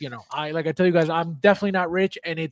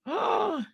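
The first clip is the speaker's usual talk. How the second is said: sharp gasp; 13 semitones higher, 5 dB quieter than usual. Real, not acted. Sudden realisation he's right.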